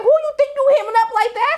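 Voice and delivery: pitched high, sassy challenge; high mock confrontation. Recapper voicing Roulette confronting Duffy, high and sassy. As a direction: snap it high and loud. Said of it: "She was like".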